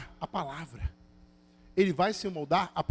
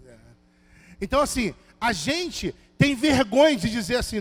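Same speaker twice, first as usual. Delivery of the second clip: usual speaking voice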